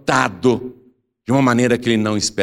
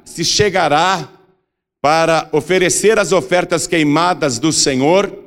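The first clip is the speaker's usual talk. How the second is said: slow, raised, declamatory Bible reading; scripture proclamation; 6 semitones higher than usual. A narrator's reading. Preacher reading Leviticus on future priests, solemn proclamation. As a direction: proclaim the law phrase by phrase, higher. Reads scripture aloud to congregation.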